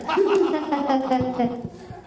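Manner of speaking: staccato pretend cackle; hammed-up ha-ha of scorn; mocking laugh. Shown as a demonstration. King Jehoiakim laughing at frightened officials, mocking laugh. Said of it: "you laugh at them too".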